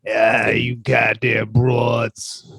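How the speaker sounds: put-on low, slow grumpy old New Yorker; grumpy old man. Host imitating Phil Hartman's old New York character, low and slow. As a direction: growl it out like a grouchy old man. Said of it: "He looks like he talks like this".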